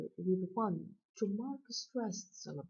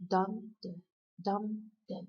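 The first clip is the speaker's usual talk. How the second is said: each "dum" stressed, each "da" light; repeated strong-weak pulse, "DUM da, DUM da"; trochaic stress. Shown as a demonstration. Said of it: "stressed syllable and ends with an unstressed".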